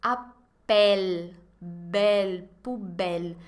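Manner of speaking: "Appel" with a wide open è; open E demo. Shown as a demonstration. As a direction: stress the open E in "belle" and "poubelle". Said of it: "pronunciado bem abertão". (E bem abertão em "Appel, belle, poubelle")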